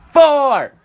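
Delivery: high shouted countdown number; new year countdown crowd. Real, not acted. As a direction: yell it out with the crowd.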